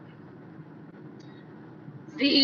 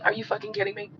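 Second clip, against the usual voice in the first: softer, deadpan disbelief with no heat; detached; 9 dB quieter, faster than usual. Shown as a demonstration. Showing viewers he's not emotionally in it, softer deadpan. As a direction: say it like you don't care. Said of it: "do I have a lot of feelings about it? Not really".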